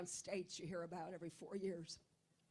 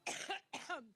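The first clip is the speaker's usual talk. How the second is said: harsh dry cough; coughing fit; 7 semitones higher than usual. Real, not acted. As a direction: cough hard, can't stop. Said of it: "I get allergic".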